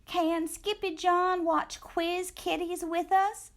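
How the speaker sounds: high, pleading little kitten voice; little sister asking. A narrator's reading. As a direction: ask sweetly as a little girl. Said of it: "asked Jilly Boo".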